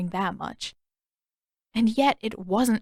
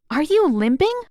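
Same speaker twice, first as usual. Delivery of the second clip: surprised question; 7 semitones higher, 6 dB louder than usual. Acted. Katara notices his limp.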